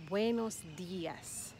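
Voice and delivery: slow "Buenos días", stressing DÍ. Shown as a demonstration. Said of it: "The emphasis is on the I".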